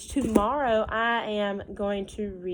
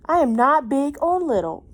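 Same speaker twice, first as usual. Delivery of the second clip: put-on louder, higher little boy declaring; Harold speaking up; 6 semitones higher, 10 dB louder than usual. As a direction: announce it firmly as Harold. Harold's line in the book.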